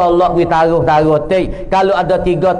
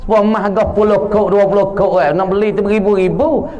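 normal talking voice

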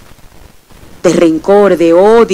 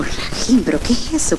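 breathy hushed whisper, much softer; awed whisper on waking from the dream; whispered wonder; 9 dB quieter than usual. Retold or imitated. Retold waking puzzlement.

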